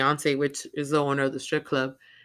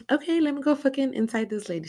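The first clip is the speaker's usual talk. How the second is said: high mock voice; 11 semitones higher than usual. Girl's inner voice, mocked.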